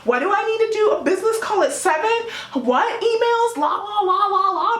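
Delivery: frantic high busy voice; work mode. Retold or imitated. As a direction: rattle off tasks in a high rush. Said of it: "you'll see me go into work mode".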